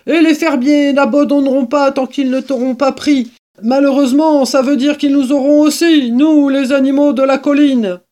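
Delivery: high, loud angry tirade; furious. Acted. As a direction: rail at the one to blame. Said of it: "d'un ton furibond".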